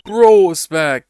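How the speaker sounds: booming, slow announcement; loud dramatic entrance. Acted. Player greets returning character with a slow booming call.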